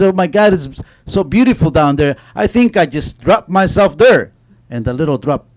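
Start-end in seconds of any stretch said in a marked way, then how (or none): 0.0-4.3 high, loud burst of wonder; excited exclamation. Acted. Little drop of water marvels at what's below, excited. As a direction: exclaim it in a raised, thrilled voice.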